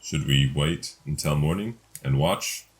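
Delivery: ordinary talk, nothing marked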